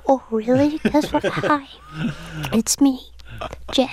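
high hand-puppet voice. Retold or imitated. Singer introducing herself as her bandmate, hand-puppet impression. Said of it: "she sounds like a hand puppet".

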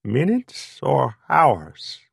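slow, knowing Greek waiter; drawn-out teasing Greek accent. A narrator's reading. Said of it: "the waiter asked knowingly".

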